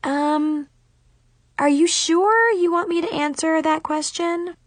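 high, careful mock-polite question; snarky hesitation. A narrator's reading. Girl answering whether rival looks stupid, high and snarky. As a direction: ask it delicately, needling her.

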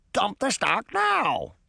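high, slow parrot squawk; screechy bird voice, words stretched; shrieking parrot. A narrator's reading. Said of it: "the pirate's parrot shrieked".

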